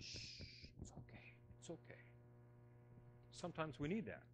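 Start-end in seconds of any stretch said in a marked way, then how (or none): 0.0-2.0 put-on hushed, breathy shush to calm a crying baby; soothing whisper. Grandparent hushing a crying granddaughter, soft soothing whisper. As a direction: whisper it's okay like rocking a child to sleep.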